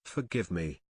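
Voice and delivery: low, muted and soft; muffled voice. A narrator's reading. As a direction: speak muffled, holding back grief. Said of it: "in a muffled voice".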